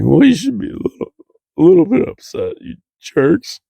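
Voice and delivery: high, petulant fake-crying voice; mock tearful whine. Crying patient scolding unbothered paramedics, mock tearful pouting. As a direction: whine it like a hurt, weepy kid. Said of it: "I started crying".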